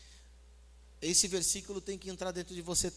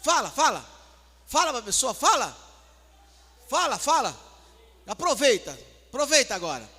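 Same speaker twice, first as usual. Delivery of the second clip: loud, high shouted commands; hammed-up frantic urging; urgent goading; 7 semitones higher, 12 dB louder than usual. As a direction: yell at them to speak up. Goading congregation to vent loudly.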